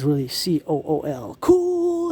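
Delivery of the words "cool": squeaky very high cartoon voice; ghost voice. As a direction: pipe it up high like a toy ghost. High voice greeting ghost decorations.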